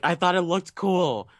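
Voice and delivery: high, drawn-out mock protest; imitated director making excuses; defensive director. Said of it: "He's like".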